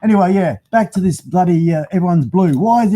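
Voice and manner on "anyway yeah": ordinary delivery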